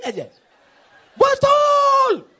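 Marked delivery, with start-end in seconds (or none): 1.1-2.3 very high, loud drawn-out yell; full shout. Acted. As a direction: belt out the word at the top of the voice. Mimics an outburst of agreement. (teriakan nyaring memanjang)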